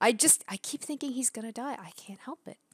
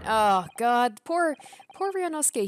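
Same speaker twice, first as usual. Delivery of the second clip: dismayed pity; 4 semitones higher, 7 dB louder than usual. Real, not acted. Pities the accused protagonist.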